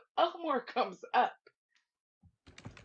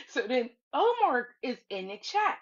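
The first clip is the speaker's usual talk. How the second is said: ordinary talk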